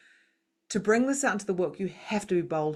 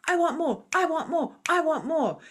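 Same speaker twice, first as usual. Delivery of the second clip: high, pushy inner voice demanding more; greedy ego voice; 10 semitones higher, 3 dB louder than usual. Retold or imitated. Ego pushing a husband and friends to keep up, demanding and raised. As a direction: play the grasping ego. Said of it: "when your ego is like".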